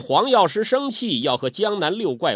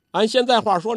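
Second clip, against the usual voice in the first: ordinary delivery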